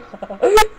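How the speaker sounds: high, loud burst of laughter; real laughing fit. Real, not acted. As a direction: lose it completely. Reader cracks up mid-story.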